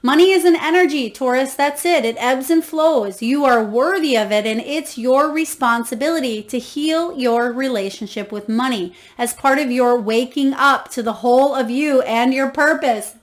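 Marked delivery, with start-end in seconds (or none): none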